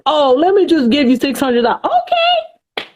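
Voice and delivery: high, naive acting-out of saying yes; gullible acceptance. Acts out gullible old self accepting.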